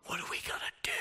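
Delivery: whispered in mock panic; hushed, frightened whisper; scared whisper. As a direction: whisper it like hiding from the storm. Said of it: "you're like".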